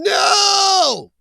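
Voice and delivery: sudden high loud NO, like a scream in your head; inner scream. Retold or imitated. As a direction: shout the refusal you're holding inside. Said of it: "you're screaming".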